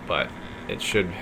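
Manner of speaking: ordinary talk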